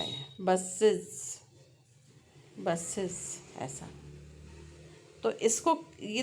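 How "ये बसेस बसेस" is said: बसेस drawn out, with the last syllable ending in a clear iz; teacher sounding out बसेस; slow buses demo. Shown as a demonstration. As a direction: model बसेस slowly with two full syllables. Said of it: "ऐसा हो जाएगा".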